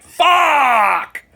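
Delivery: high, loud cry of frustration; frustrated yell. Retold or imitated. Price won't scan at the register, loud frustrated yell. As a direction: let it burst out in exasperation.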